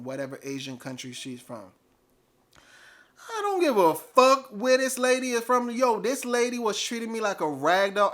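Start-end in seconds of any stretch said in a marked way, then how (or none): 3.3-8.1 raised, higher-pitched angry protest; indignant outburst. Retold or imitated. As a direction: snap back loudly at a lame excuse.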